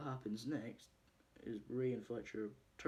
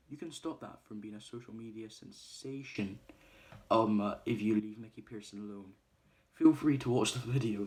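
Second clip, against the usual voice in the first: retold threat; 11 dB louder than usual. Threatening video message in film.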